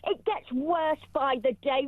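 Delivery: usual speaking voice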